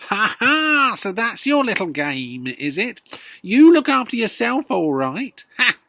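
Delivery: put-on loud, high, sneering old woman; accusing grandma. Grandma accusing George of selfishness, sneering loudly. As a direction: jeer at the boy.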